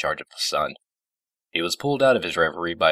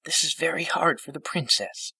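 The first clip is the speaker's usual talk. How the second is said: put-on gentle old pony, reproving; 12 semitones higher than usual. A narrator's reading. Fluttershy defending the princess, high and gently reproving.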